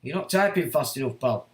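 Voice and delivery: ordinary delivery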